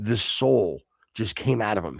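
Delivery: ordinary talk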